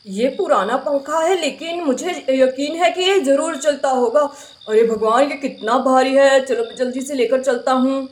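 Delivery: low husband voice, a bit put-upon; brother Shyam's voice. Acted. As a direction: play the tired older brother grumbling over a broken fan. Brother Shyam voiced by same actor.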